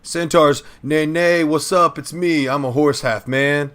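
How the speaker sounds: high, loud horse-man voice; hype man flair. Acted. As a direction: brag like a cocky centaur. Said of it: "I'm gonna give a little bit of flair to it".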